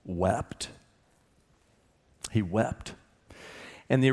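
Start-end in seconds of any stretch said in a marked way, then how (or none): 0.0-2.9 hushed solemn. A narrator's reading. Quiet solemn reading.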